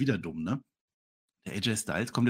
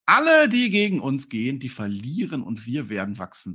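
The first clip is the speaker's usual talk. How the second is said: loud, high, drawn-out opening that settles down; wrestler's proclamation; 3 semitones higher, 7 dB louder than usual. Retold or imitated. Wrestler threatens all rivals, slow proclamation. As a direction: proclaim it slowly like a wrestling promo. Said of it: "sagt einer von denen".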